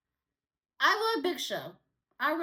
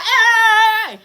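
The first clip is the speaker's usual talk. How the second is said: sudden loud "Hey!" shout; 6 semitones higher, 14 dB louder than usual. Retold or imitated. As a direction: yell it to stop him. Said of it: "Big Show's like".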